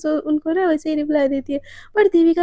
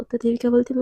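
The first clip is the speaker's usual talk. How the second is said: usual speaking voice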